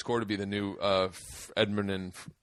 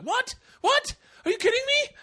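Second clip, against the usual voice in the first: shrieking high mock disbelief in a put-on accent; outraged falsetto squeal in a bad foreign accent; shocked in bad accent. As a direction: squeal it like the radio hosts just got spoiled. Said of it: "I'm doing a bad accent".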